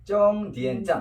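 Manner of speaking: 쫑디엔 짠 said as Chinese zhōngdiǎn zhàn; Chinese pronunciation demo. Shown as a demonstration. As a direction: model 쫑디엔 짠 as Chinese, with tense onsets and n endings. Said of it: "중국 발음은 종점 참과 비슷하게 쫑디엔".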